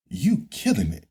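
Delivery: ordinary delivery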